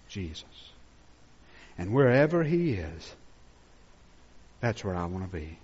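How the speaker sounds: tender, quiet confession; soft reverent sermon voice; hushed. Real, not acted. Intimate quiet declaration.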